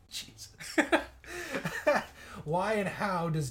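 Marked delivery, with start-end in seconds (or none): 0.1-0.5 breathless laughing exclamation. Real, not acted. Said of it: "couldn't even get through that without laughing". 0.5-2.4 real high burst of laughter. Real, not acted. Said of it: "couldn't even get through that without laughing".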